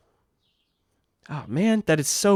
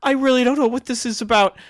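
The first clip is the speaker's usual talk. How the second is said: put-on higher-pitched, pleading girl's voice; pleading young girl; 11 semitones higher, 4 dB louder than usual. Accused girl pleads with a passer-by, pleading. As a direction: plead innocence in a high voice. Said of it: "looks at you with pleading eyes and says".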